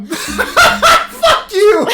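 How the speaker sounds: high, loud laughing yell; laughing shout. Real, not acted. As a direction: yell it while cracking up. Friend hides big news, mock outrage.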